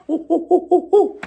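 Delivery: very high, loud ooh ooh hoots; monkey hooting. Acted. Monkeys mocking the peddler with high hoots. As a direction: hoot like a cheeky monkey. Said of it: "¿Cómo lo hicieron los monos?".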